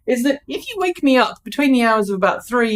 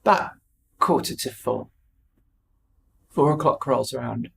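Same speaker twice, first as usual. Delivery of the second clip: usual speaking voice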